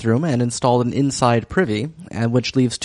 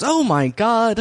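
drawn-out, high-pitched imitation; funny Daisy exclamation; 9 semitones higher, much slower than usual. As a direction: stretch it out high, imitating her odd tone. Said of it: "She says it so funny".

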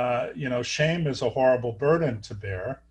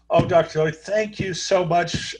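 mock gushing, high and bright thanks; 5 semitones higher, 3 dB louder than usual. Retelling a radiology tech thanking the doctor, gushing mock thanks. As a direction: imitate the tech's effusive gratitude.

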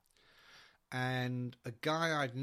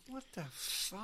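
bewildered; 5 semitones higher, 7 dB quieter, slower than usual. Retold or imitated. Bewildered thought, acted.

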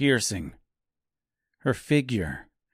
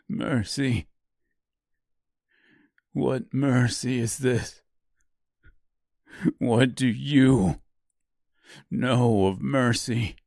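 slow, bitter, rising challenge; bitter anger; slower than usual. Acted. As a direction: throw her word back at her. Grieving man bitter challenge.

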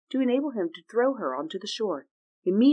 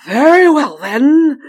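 very loud, higher booming wind; booming North Wind; 8 semitones higher, 16 dB louder, slower than usual. Acted. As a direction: thunder it out grandly.

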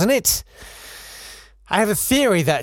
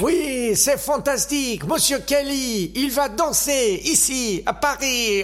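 high, gushing mock-French film star; Gene Kelly; 8 semitones higher, slower than usual. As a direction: show off in hammed-up, drawn-out French. Sketch: Kelly interrupts in gushing French.

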